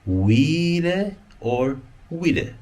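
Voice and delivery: wi le then wi le, vowel length contrasted slowly; contrasting short and long vowel. Shown as a demonstration. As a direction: stretch the long vowel in the second wi le. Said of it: "I have to distinguish".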